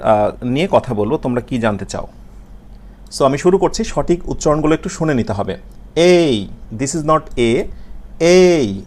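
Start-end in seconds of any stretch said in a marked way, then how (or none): 6.0-8.8 the letter এই spoken clearly, like "ay". Shown as a demonstration. Teacher modelling এই for students. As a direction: say A as এই, not "a". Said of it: "মানে আমরা এরকম বলি না".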